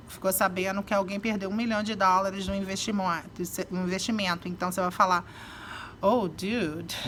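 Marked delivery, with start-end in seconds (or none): 6.0-6.9 sympathetic groan. Shown as a demonstration. Hearing someone lost a million dollars, dismayed. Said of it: "depende da entonação".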